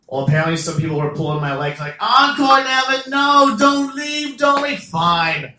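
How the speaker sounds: usual speaking voice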